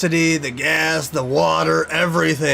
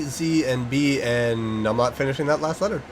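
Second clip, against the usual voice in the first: ordinary talk, nothing marked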